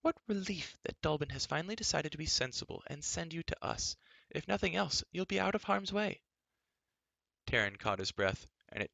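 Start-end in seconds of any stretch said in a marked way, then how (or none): 0.0-6.2 much higher, fluttery scolding voice; fussy queen. A narrator's reading. Queen Teleria scolding the princess, much higher fussy voice. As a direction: fret like a prim old queen.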